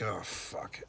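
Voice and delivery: low, very soft swear to himself; retold sinking reaction, dropped right down; dreading aside. His dreading reaction to being approached.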